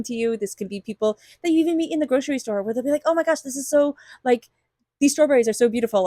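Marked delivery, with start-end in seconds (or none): 3.1-6.1 quick, higher-pitched mock gush; acted-out excitement, hurried and lifted; passionate gushing. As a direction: gush like an enthusiastic stranger sharing a passion. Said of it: "very passionate about what they're doing".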